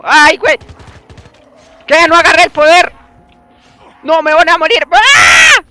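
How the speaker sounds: loud high panicked yelling; real panic shouting. Real, not acted. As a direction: scream as you're overrun. About to die in game.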